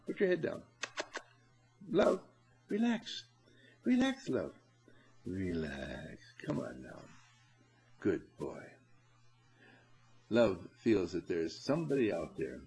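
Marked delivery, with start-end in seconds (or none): 0.1-7.0 soothing. Real, not acted. Soothing a dog. 8.0-8.6 soothing. Real, not acted. Praising a dog.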